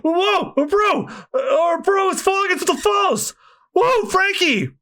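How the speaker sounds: high alarmed cries; panicked cry. Acted. Henchmen watching their boss fall, crying out high.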